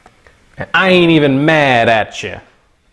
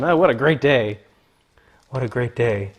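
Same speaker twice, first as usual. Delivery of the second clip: ordinary delivery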